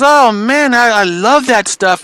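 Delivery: high, slowing, gushing dad quote; retold delight. Son quoting his dad's reaction to the panda, high delight. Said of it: "He goes".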